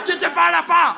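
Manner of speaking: very high mock-French yell; mad Frenchman. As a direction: imitate an enraged Frenchman shrieking. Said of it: "he got even mad".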